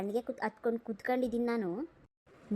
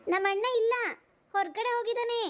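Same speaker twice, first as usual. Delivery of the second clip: higher answering voice from inside; girl's voice; 8 semitones higher than usual. Acted. Young girl answers the visitor from inside, in a higher voice.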